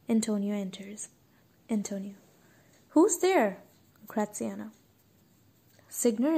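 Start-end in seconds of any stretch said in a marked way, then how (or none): 2.9-3.6 loud high call into the dark. Acted. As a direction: call out, startled. Antonio calls into the dark.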